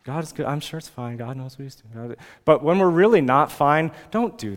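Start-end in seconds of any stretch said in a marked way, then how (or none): 0.0-2.2 soft, half-hearted acceptance; dismissive self-reassurance. Acted. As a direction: quietly pretend all is fine. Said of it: "to just kinda say".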